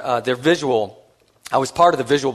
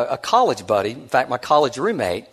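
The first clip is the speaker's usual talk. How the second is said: ordinary talk, nothing marked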